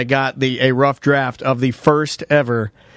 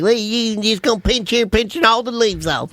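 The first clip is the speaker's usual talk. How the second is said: higher-pitched put-on old man voice; 5 semitones higher than usual. Old man on a video showing how to pinch pepper leaves, mimicked old-man voice. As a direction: mimic an old man giving pruning tips. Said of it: "I watch this other old man".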